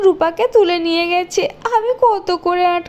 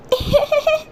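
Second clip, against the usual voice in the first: put-on high, eerie child's laugh; spooky high-pitched titter; ghostly giggle; 9 semitones higher than usual. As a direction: giggle like a haunting little ghost. Ghost girl before punishing.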